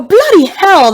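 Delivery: high, punchy mock British; hammed-up British outrage; British slang exclamation. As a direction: exclaim it as a put-on Brit. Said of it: "we had this slang we would use".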